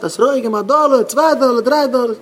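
high, loud street hawker's call; imitated shouting. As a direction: shout out rising prices like an auctioneer. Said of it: "man schreit".

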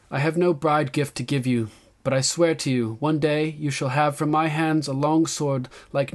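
high, solemn queen; young queen's formal address. A narrator's reading.

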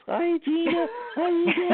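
high squeaky hand puppet voice; little puppet voice. Acted. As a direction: chirp it like a tiny puppet greeting a kid. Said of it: "talking in a little puppet voice".

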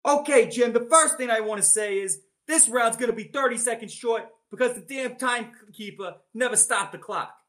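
high, loud New York commentator voice; New York accent. Acted. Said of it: "another just classic New York fighter".

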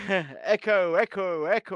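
testing a live echo filter, word repeated into the mic; echo effect. Shown as a demonstration. Streamer tests echo effect for chat, echoing call. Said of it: "it's like you're in a tunnel".

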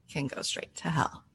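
hissed whisper. Real, not acted. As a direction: whisper it with contempt. Victim's parting words to attacker.

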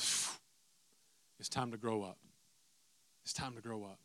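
soft, low, sinking into the truth; quiet phew of realizing; hushed realization. Retold or imitated. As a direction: breathe it out to yourself. Inner realization, exhale.

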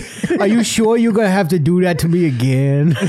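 put-on high whiny child voice; little kid voice. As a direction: plead like a small boy. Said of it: "a little kid voice".

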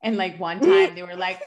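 ordinary talk

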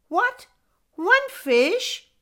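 very high, startled questions; incredulous grandmother, pitch leaping up; dismayed disbelief. A narrator's reading. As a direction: squeak it out in shock. Grandmother dismayed at the small catch.

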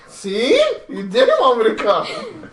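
laughing gotcha. Real, not acted.

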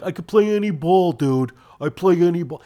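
high, loud, put-on cocky bro voice; weekend warrior bro. Weekend golfer brushing off ball choice, cocky bro. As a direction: brag like a casual hacker who thinks every ball's the same. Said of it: "you're like".

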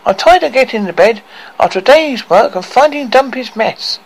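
high, fed-up complaining. A narrator's reading. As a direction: complain bitterly.

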